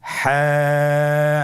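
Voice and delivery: হ্যাঁ held very long with a throaty, squeezed h; drawn-out letter name. Shown as a demonstration. Teacher leads students in repeating the letter, slowly drawn out. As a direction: hold হ্যাঁ long, pressed from mid-throat. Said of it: "আপনারা আমার সাথে বলুন".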